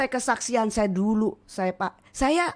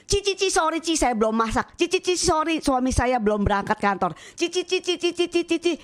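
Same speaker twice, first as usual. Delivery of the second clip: much higher, hurried stammering apologies; flustered excuses; 6 semitones higher than usual. Retold or imitated. Mothers fleeing her invitation to worship, high flustered apologies. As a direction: back out in a panic, Ci Ci Ci.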